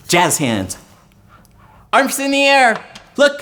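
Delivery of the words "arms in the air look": high, loud call to the riders; pitched up with playful thrill; rally the kids at the top; excited ride leader. Pretend. Top of imaginary coaster ride.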